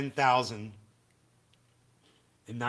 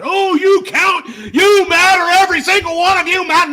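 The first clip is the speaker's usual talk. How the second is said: high, loud mock-sincere shout; mocking quote; 14 semitones higher, 15 dB louder than usual. Streamer mocking politicians telling voters they matter, loud falsetto parody. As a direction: parody the fake reassurance, shouting. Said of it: "It's a lie when they tell you that".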